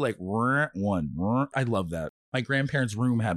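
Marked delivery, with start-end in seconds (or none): 0.2-1.5 rotary dial noise. Retold or imitated.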